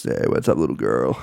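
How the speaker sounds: low, whispered sleazy come-on; TikTok fuckboy voice. Shown as a demonstration. Showing the podcast how a TikTok fuckboy talks, low and whispered. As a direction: lean right into the mic and purr it. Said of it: "a TikTok fuckboy voice".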